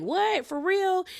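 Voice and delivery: high, disbelieving what; shocked. Retold or imitated. Castmates shocked at the news, high disbelief. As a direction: gasp it out in disbelief.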